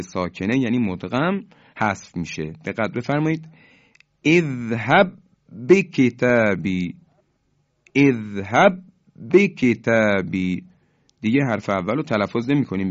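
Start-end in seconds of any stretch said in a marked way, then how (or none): 4.2-6.9 اذهب به کتابی with one firm, doubled b; merged emphatic b. Shown as a demonstration. Teacher showing how the two letters merge, firm merged b. 7.9-10.6 اذهب به کتابی as one held, forceful b; merged emphatic b. Shown as a demonstration. Teacher demonstrating the merged b to students, firm and slow.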